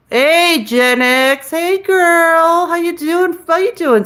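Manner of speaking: chirpy high hello to the chat; high sing-song greeting. Pretend. Streamer greeting her viewers in a high sing-song voice.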